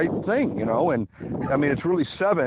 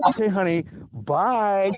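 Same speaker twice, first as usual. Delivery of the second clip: sugary goodbye; 7 semitones higher than usual. Retold or imitated. Sweet goodbye after spouse's insults.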